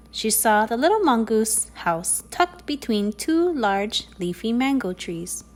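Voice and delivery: ordinary delivery, nothing marked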